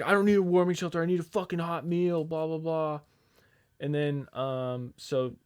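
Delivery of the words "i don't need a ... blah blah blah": higher, louder mock rant; retold screaming. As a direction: imitate the customer yelling at the manager. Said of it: "'cause he's screaming at her".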